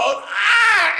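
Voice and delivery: loud, high yelp of pain; pain cry. Retold or imitated. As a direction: yell like you got hit on a bad elbow. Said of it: "he goes".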